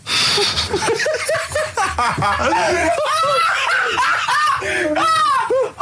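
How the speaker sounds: high hysterical laughter, near screaming; a helpless shriek of laughter that nearly turns to crying. Real, not acted. Hysterical laugh at Carl's quote.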